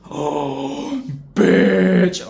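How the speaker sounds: gasp, then blurt it out loud in shock. Real, not acted. Reacting to the teacher's burn with a loud shocked gasp.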